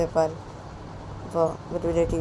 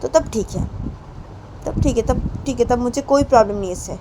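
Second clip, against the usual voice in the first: put-on lightly raised, pleading girlfriend voice scolding her partner's drinking; Payal, hurt girlfriend; 6 semitones higher, 5 dB louder than usual. Payal tells Poonam she hates the drinking, hurt and pleading. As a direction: complain with hurt, half-tearful insistence.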